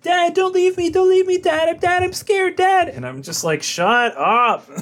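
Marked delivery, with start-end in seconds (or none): 0.0-2.9 hammed-up high-pitched whine; mock a whiny kid pleading in panic; squealing, exaggerated whiny pleading; whiny imitation. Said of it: "she's so whiny". 3.6-4.7 raised-pitch, exasperated retort; fed-up retort to a whiner, played for laughs; half-joking exasperated outburst; mock exasperation. Retold exasperated reply to whining.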